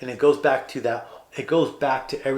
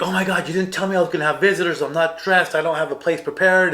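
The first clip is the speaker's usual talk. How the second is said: fast, higher and louder protest; flustered, mad; 5 semitones higher, 6 dB louder, faster than usual. Retold or imitated. Adrian caught unready by a visitor, flustered and mad. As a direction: rattle off a retold complaint in a fluster. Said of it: "she's all mad".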